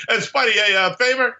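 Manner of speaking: high pleading little voice; webbed-up guy. Retold or imitated. Hanging from a web, calling up to Spidey, high and pleading. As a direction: ask a favor, a bit whiny and high.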